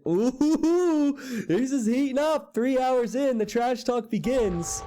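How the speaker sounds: high, thrilled caster voice, drawn out; excited hype. Real, not acted. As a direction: build the drama of the rivalry. Caster hypes rising rivalry.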